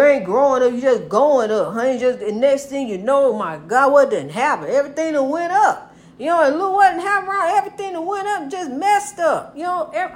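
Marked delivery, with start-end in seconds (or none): 4.7-10.1 high, rushed rant about prices; talking fast. Real, not acted. Ranting to viewers about rising costs, fast and high. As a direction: race through it like the rat race. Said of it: "I'm talking so fast 'cause it's like that".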